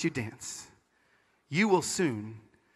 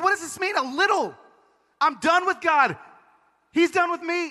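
high-pitched anguished protest; re-enacted despairing rant, high and strained; desperate outburst; 9 semitones higher than usual. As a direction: cry it out, pitched way up. Re-enacts desperate phone call to friend.

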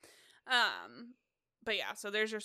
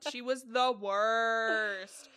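disgust; 6 dB louder, much slower than usual. Real, not acted. Hating on a reality star.

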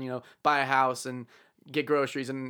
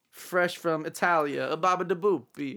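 mock Italian accent.

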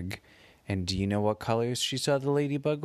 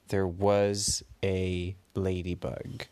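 ordinary delivery, nothing marked

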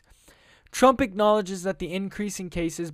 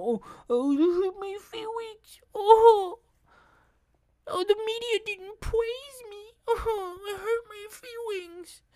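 much higher, whimpering mock sob of a hurt man; whiny mock crying Trump; slower than usual. Mocking Trump as a crybaby over the media, whimpering high. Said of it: "hurts his little feelings".

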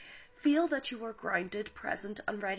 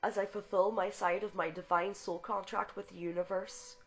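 louder, earnest invocation; fervent spoken invocation; prayer; 4 dB louder than usual. Acted. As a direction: proclaim the prayer with conviction. Reads invocation and healing prayer.

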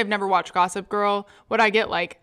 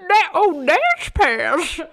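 put-on old man voice; 7 semitones higher, 4 dB louder, much slower than usual. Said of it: "that old man voice".

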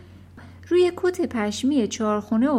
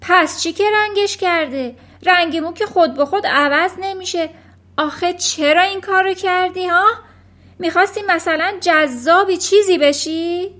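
high, loud scolding; exasperated wife's yelled telling-off; angry shouting; 7 semitones higher, 7 dB louder than usual. A narrator's reading. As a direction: berate your husband at full voice. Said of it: "مادر داد زد".

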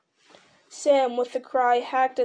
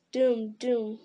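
plain reading voice

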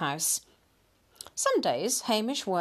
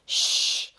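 forceful voiceless "shh"; loud shushing; 5 dB louder than usual. A narrator's reading. Husband hushing his wife so the cat won't hear, urgent shush. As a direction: hush someone urgently. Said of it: "whispered Mister Grinling".

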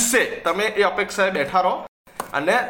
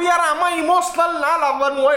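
exasperated retort; 8 semitones higher than usual. Retold or imitated. Retort to parents.

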